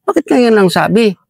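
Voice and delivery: high, sharp exclamation of disbelief; exasperated outburst. Retold or imitated. The officer is exasperated the speaker asked for help so late, voiced in a high, cursing burst. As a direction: burst out with a high, cursing "why only now". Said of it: "Sabi niya".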